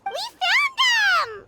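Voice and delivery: high, slow, drawn-out cry of joy; excited discovery. Acted. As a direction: call out the good news, stretching it. Finding the lost baby Saurians.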